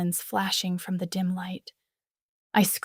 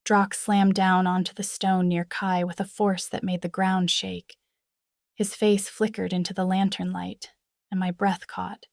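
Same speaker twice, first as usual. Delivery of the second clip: ordinary delivery, nothing marked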